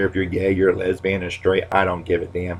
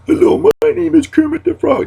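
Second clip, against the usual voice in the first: high, thin puppet frog voice; Kermit the Frog; 4 dB louder than usual. Pretend. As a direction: squeak it out like a felt puppet. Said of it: "had a frog in my throat".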